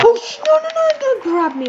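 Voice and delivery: high, loud yelp of protest; sudden high alarm. Real, not acted. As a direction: cry out as if grabbed. Being grabbed in game.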